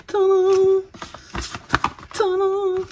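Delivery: sung high "tadam" fanfare; playful little fanfare; mock fanfare. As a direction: announce the product like a trumpet. Fanfare reveal of a product.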